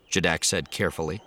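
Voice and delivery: ordinary delivery, nothing marked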